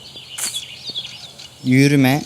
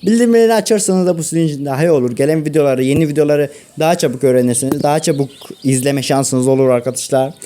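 youtuber outro; 4 semitones higher, faster than usual. Acted. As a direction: sign off the video to viewers. (yüksek sesli hızlı youtuber kapanışı)

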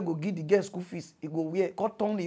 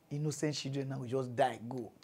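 normal talking voice

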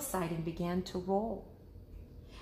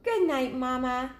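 high, small cub voice; little cub asking; 6 semitones higher, 4 dB louder than usual. Acted. Cub asking mother about sleeping outside, high little voice. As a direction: ask like a curious little bear cub. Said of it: "said Baby Bear".

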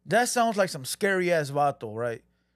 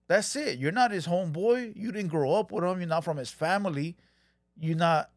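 ordinary talk

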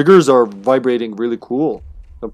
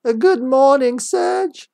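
very high sing-song mother voice; mom's wake-up call; 11 semitones higher, slower than usual. Acted. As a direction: chirp good morning like a mom. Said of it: "a guy being called by his mom".

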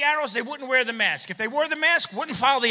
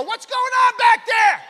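loud, higher, slow shout; 12 semitones higher, 11 dB louder, slower than usual. Retold or imitated. Customer yelling at the cashier through plexiglass, loud. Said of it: "I go".

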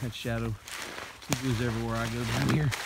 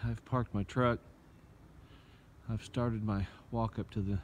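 ordinary talk, nothing marked

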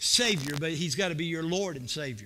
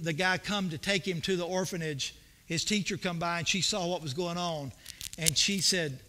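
ordinary talk

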